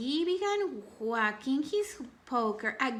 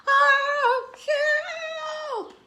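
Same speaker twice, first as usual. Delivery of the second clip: high, loud sung-out cry of help; frightened cry for help; 8 dB louder than usual. Acted. Boys cry out as the hat creeps closer, scared and loud.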